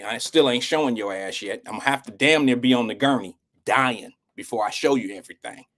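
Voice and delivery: usual speaking voice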